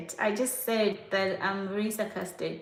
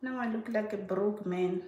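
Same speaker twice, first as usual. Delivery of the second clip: usual speaking voice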